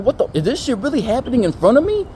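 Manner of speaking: high, loud mock disbelief; acted-out appalled amazement; incredulous. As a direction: react in exaggerated shock. Retold disbelief at what happens.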